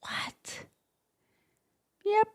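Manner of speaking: breathless whispered what; whispered disbelief. Pretend. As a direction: gasp it under your breath. Mock disbelief aside.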